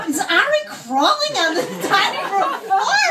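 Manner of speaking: high, hammed-up bewildered questioning; incredulous. Host spotting someone crawling in the dining room, incredulous. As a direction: act out baffled disbelief, pitch climbing. Host retells her baffled discovery.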